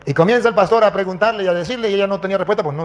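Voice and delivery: usual speaking voice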